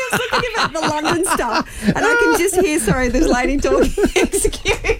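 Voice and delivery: high, laughing put-on posh English voice; mock posh English accent. Host mocking an English lady while reading Game of Thrones, posh English accent. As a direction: giggle through a hammed-up English lady accent. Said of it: "You sound like Molly Meldrum with Prince Charles".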